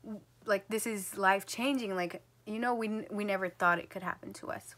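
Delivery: ordinary talk, nothing marked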